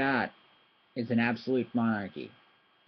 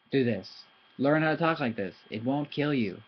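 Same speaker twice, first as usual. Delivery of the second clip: ordinary talk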